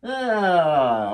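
long, loud falling groan; frustrated groan. Real, not acted. Painter screwing up while people watch live, frustrated groan. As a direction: groan out the pressure. Exasperated after a failed take.

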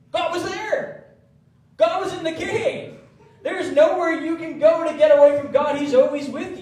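high, loud and laughing, slowing on "God was there!"; excited exclaim. Real, not acted. Preacher thrilled to tell the church God was there. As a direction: shout the news with delight. Preacher's climax on God's presence.

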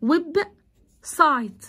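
"ويب سايت" with a clear pause between web and site; two split syllables. Shown as a demonstration. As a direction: give each half of "ويب سايت" its own beat. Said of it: "ويب مقطع وسايت مقطع". ("ويب سايت" مقطعين بينهم سكتة)

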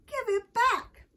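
high, loud, slow demand; demanding cry. A narrator's reading. Michael demands the puzzle piece from Rachel, demanding cry. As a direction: cry it out loud, stretching each word. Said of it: "cried Michael".

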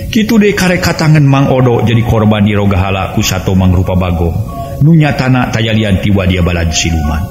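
usual speaking voice

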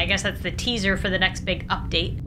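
ordinary delivery

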